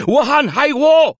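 loud, high, slow shout in Hindi; a pursuer bellowing across the terrace; yelled. Acted. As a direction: yell out that you've spotted her. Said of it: "hurla-t-il".

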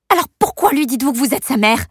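loud, high demanding question; angry shout. Acted. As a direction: confront her hard. Amber shouting at the babysitter.

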